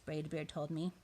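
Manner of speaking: ordinary delivery, nothing marked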